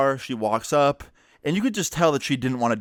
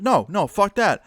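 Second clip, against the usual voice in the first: angry outburst; 8 semitones higher than usual. Retold or imitated. Mom furious at the dad.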